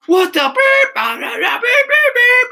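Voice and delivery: high, loud mock tirade; screaming customer. Irate customer storming into the shop, mock screaming. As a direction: imitate a furious customer blowing up. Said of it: "so he's screaming at me".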